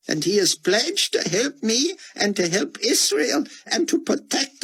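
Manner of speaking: put-on high, anxious old scholar voice; pleading old man. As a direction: beg as a frightened old man. Scholar insisting hopefully.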